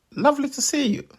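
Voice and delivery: high squeaky melon greeting; tiny melon voice. Acted. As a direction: chirp politely like a talking melon.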